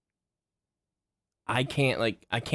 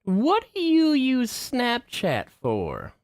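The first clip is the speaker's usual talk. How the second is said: slow, high incredulous question; 12 semitones higher, much slower than usual. Retold or imitated. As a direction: stretch it out in disbelief, pitch way up. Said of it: "I was like".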